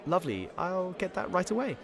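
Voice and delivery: a chirpy waitress, only hinted; lightly voiced chirpy waitress. Waitress promising the tea, lightly voiced and chirpy. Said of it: "The barmaid slash waitress".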